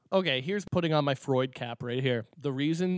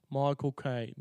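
quick Cockney "Michael Caine", run together like "my cocaine"; a light Michael Caine London accent, said fast; 4 dB quieter than usual. Shown as a demonstration. Mimicking the actor's London accent for friends. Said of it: "sounds like saying Michael Caine in his accent".

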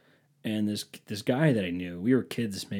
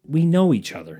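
louder, higher annoyed reply; acted-out touch of anger; retold irritation; 5 semitones higher, 5 dB louder than usual. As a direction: answer as if mildly affronted. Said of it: "mad at him".

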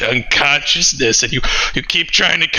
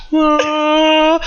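high wah; 12 semitones higher than usual. Acted.

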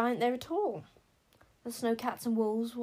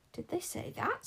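outraged; 10 semitones higher, 3 dB quieter than usual. A narrator's reading.

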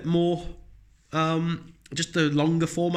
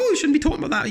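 high, prim, disapproving mock voice; prissy objector; 13 semitones higher, 3 dB louder, faster than usual. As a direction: parody a pearl-clutching critic, pitched high. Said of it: "some people are like".

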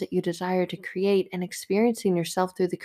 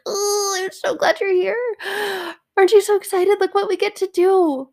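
joyful welcome; 12 semitones higher, 7 dB louder than usual. Pretend. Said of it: "he's like".

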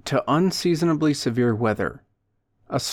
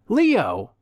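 high, emphatic exclamation; raised-pitch hearty call; loud self-introduction; 7 semitones higher than usual. A narrator's reading. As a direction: announce yourself brightly to an old pal. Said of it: "he self-identified loudly".

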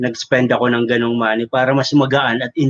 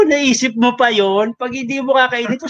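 incredulous retort; 9 semitones higher than usual. Retold or imitated.

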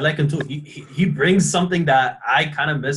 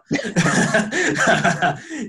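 burst of laughter; 10 semitones higher, 4 dB louder than usual. Real, not acted. Laughing at his own joke.

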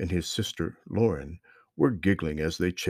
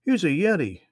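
put-on high, loud friendly boy; young boy voice; 10 semitones higher, 5 dB louder than usual. A narrator's reading. Strange boy greets the lost children, high friendly voice. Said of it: "said the boy".

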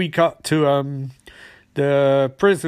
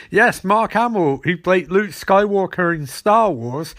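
normal talking voice

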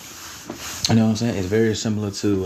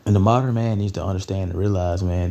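ordinary delivery

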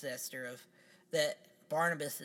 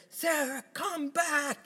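much higher, slow overwrought wail; hammy stage actor; 6 dB louder, slower than usual. Retold or imitated. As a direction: overact the plea like bad melodrama.

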